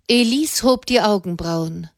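ordinary delivery

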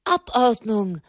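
squeezed out with effort; a lightly voiced breathless prelate; forced out. A narrator's reading. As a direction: push the one word out breathlessly. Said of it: "brachte Tommaso di Petra heraus".

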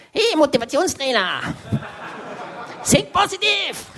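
high, cheesy motivational coach voice; pushy friend. Retold or imitated. Best friend mocking the grumpy motivational trainer, high and hyped. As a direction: bounce it out like an overeager hype coach. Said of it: "mein bester Freund und tut so".

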